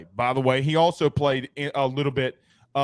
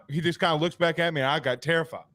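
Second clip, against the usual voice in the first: usual speaking voice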